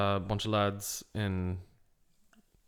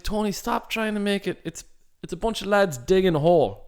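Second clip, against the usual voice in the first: mildly exasperated, high-pitched plea; playful, high-pitched telling-off; mock exasperation; 8 semitones higher, 3 dB louder than usual. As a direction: plead with the presenter, voice pitched up.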